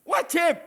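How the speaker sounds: "Wajib" hit high and loud; emphatic answer. Real, not acted. Preacher insists to the congregation that worship is obligatory, answering loud and high. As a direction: bark out "Wajib" as a firm verdict. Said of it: "Bukan penting".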